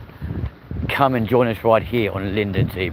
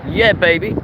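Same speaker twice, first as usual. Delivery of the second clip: excited brag; 7 semitones higher, 4 dB louder than usual. Real, not acted. Remembering his old Bentley with a gleeful brag. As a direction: crow over the car he used to own.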